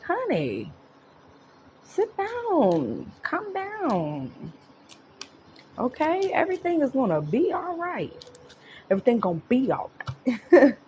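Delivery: calm a frantic friend down sweetly; soothing honey voice. Pretend. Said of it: "the divine is like".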